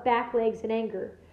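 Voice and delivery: usual speaking voice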